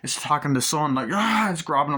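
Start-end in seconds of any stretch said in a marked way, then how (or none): 1.1-1.5 loud, high imitated cry; pitched-up horror yell; mock attack scream. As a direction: shriek like someone being grabbed. Demon attacks son, retold.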